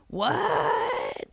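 very high, softened incredulous squeak; mock disbelief. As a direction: squeak it out in exaggerated shock. Acts out own shocked reaction.

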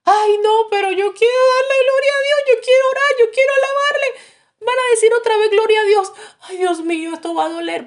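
high, loud wailing plea; crying like a child. Retold or imitated. The aching man begging to praise God, crying high and loud. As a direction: wail it out like a weeping child. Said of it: "lloraba como un niño".

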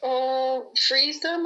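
ordinary delivery

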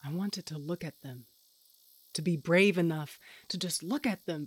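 ordinary delivery

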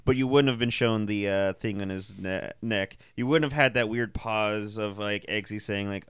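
ordinary delivery

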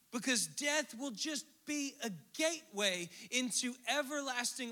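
preaching. Acted. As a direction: lift it up like a preacher on fire. Preaching at full force.

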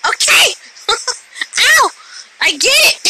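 high, pained yelling with laughter; real yelps, half laughing; pained shouting. Real, not acted. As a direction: cry uncle while being bitten. Crying out while bitten.

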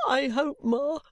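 high, tearful appeal; sobbing. A narrator's reading. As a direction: falter to mother through tears. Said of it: "sobbed poor Caddy".

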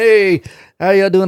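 high, slow, overly friendly greeting; fake-innocent hello to the strangers; sheepish cover-up greeting. Coworker smoothing things over with the strangers, fake-friendly.

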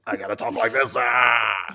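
a lightly put-on growly demon, then a yelled ah; mock demon voice. Host jokes about her own demon impression, in a hammed-up demon voice. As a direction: ham it up as a cartoon demon. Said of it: "I sound like Sling Blade as a demon".

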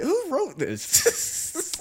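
high, drawn-out wail of disbelief; exasperated disbelief. Real, not acted. Ranting to friends about a badly written scene, exasperated disbelief. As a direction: wail it slowly, incredulous at the writing. Mocking the bad movie writing.

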